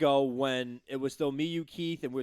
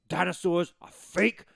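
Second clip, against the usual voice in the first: mock preacher; 2 semitones higher, 6 dB louder than usual. Imitating Carl Everett interview.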